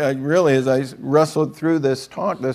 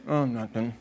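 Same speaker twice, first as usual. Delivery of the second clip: softer, lower, playing it down; mock coy mumble; 4 semitones lower, 10 dB quieter than usual. Kid hiding his new game system, soft coy mumble. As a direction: hide the news, mock shy.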